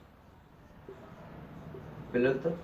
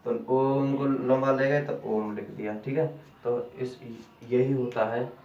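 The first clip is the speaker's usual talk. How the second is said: normal talking voice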